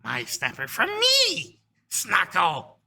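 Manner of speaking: high, loud, slow broken speech; proud kobold. Acted. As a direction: boast of your catch in a creature voice. Kobold boasts in broken common speech.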